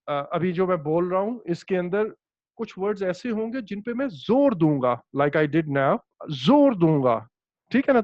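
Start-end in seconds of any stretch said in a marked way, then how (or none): 6.4-7.2 जोर hit louder and held; stress on जोर. Shown as a demonstration. Teacher showing Urdu stress on जोर. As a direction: punch the word जोर. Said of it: "इस पे मैंने जोर दिया है".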